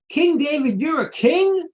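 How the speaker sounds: high, loud sneering jeer; mocking taunt. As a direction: scoff at him as the other kings would. Kings mocking King David.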